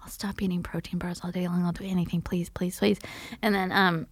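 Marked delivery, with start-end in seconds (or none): 0.0-3.0 desperate, whispered bargaining with God; pleading whisper. Retold or imitated. Patient praying before the procedure, in a pleading whisper. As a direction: beg frantically under your breath.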